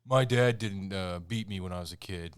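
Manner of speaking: imitating the character's backstory line; mock brooding rapper. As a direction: deliver it as a hammed-up tragic backstory. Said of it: "he says something like".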